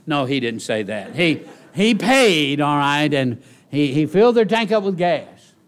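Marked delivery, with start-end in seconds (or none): 1.1-5.5 preacher building to a shout over his church; preaching at full force. Acted.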